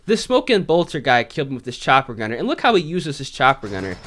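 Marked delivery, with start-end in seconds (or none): none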